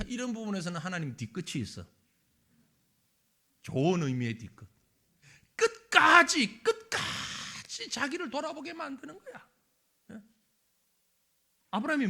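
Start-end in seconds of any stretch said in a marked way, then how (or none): 5.6-6.6 very slow, louder "끝까지" with a break in the voice; drawn out. Real, not acted. Preacher stressing God's persistence, slow and loud. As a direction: lean hard on each syllable. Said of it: "emphatic drawn-out word".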